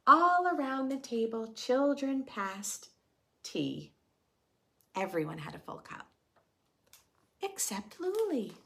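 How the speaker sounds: put-on gentle read-aloud for children; a teacher reading a picture book aloud; storybook narration. A narrator's reading.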